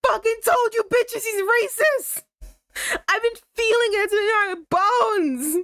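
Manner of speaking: high, loud, near-crying rant; half-sobbing shout of outrage; outraged shout. Real, not acted. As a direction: scream it out, vindicated and furious. Triumphant furious rant about character.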